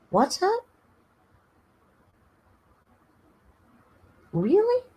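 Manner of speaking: high, mock-surprised squeak to the cat; sweet mock surprise. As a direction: pretend the cat just told a secret. Pretends the cat answers.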